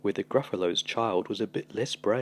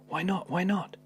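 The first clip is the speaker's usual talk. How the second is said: put-on high, eager little Gruffalo child; 9 semitones higher than usual. Gruffalo's child begging her father for answers, high and eager. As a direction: pester Dad in a small high voice. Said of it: "child voiced pleading in story".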